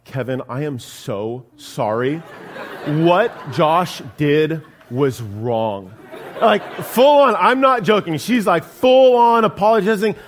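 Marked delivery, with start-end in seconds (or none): 0.0-5.9 wife mode apology. Retold or imitated. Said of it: "My wife goes full on wife mode".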